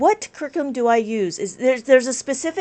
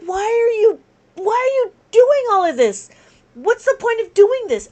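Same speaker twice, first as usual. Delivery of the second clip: higher and louder, demanding why; exasperated; 7 semitones higher, 4 dB louder than usual. Retold or imitated. Teacher retelling her reaction to the presenter, exasperated. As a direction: throw the question back in disbelief. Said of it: "And I'm like".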